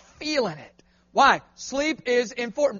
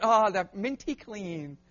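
1 savoring sigh; 3 semitones lower, 5 dB quieter, slower than usual. Retold or imitated. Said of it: "I'm like".

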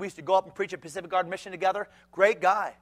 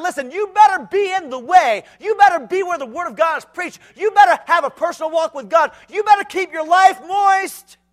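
shouted exhortation; 11 semitones higher, 11 dB louder than usual. Acted. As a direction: thunder the warning. Closing exhortation.

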